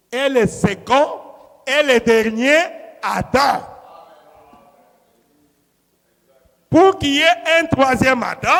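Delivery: loud, insistent pulpit argument; emphatic sermon voice in measured bursts; forceful preaching. Real, not acted. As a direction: press the point on the congregation.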